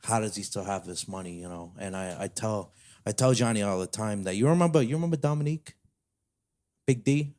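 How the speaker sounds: lightly played New York mobster bragging as Uncle Danny; New York mafia accent. Telling a cohost about Uncle Danny in a light New York mafia accent. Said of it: "in a weird little accent".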